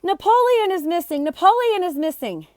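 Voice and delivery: high, loud alarmed calling; calling loudly. A narrator's reading. The prince calling loudly that Napoleon is missing. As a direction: shout it across to the neighbors. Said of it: "someone calling loudly".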